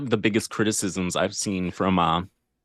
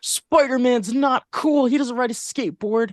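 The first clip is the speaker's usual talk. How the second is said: mocking whiny voice; 13 semitones higher than usual. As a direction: sneer like a snobby hater. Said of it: "Everyone was like".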